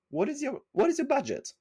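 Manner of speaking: high-pitched, careful client question; polite sample question. Shown as a demonstration. Salesperson asks the prospect about budget, polite question. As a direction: lift the voice and ask delicately.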